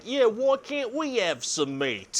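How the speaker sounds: high, drawn-out Cockney whine; hammed-up Cockney orc complaint. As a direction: plead slowly in a cartoonish Cockney accent. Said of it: "Do the orcs all have Cockney accents?".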